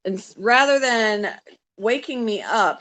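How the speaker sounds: normal talking voice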